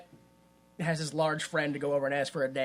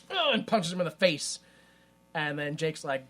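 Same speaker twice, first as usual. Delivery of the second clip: ordinary talk, nothing marked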